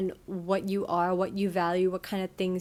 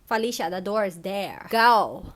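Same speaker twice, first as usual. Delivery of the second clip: dismissive; 4 dB louder than usual. Real, not acted. Dismissing a bad friend.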